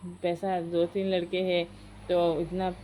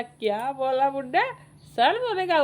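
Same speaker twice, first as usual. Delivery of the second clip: loud, high-pitched acted challenge; mock angry retort; 6 semitones higher, 5 dB louder than usual. Grandmother answers grandfather's threat in a retold fight, mock angry retort. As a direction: throw it back loudly, pitch climbing high.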